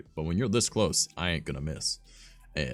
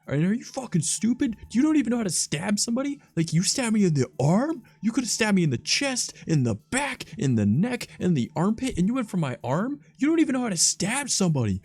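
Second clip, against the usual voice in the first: much higher, louder, exasperated scolding; mock lecture; 5 dB louder than usual. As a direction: rip into him for his bad stabbing. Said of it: "I was like".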